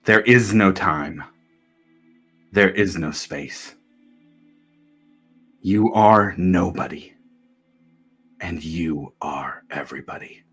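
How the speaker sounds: low, soft, fading to a whisper; hushed. A narrator's reading. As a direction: sink into a hush. Dissolving into timelessness.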